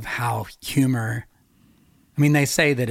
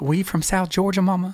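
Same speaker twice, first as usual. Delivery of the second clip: higher-pitched mock South Georgia kid; South Georgia accent; 6 semitones higher than usual. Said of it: "In that accent".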